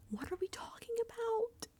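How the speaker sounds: breathy aside under the breath; exasperated whisper. Real, not acted. Vlogger muttering in disbelief at the players, whispered.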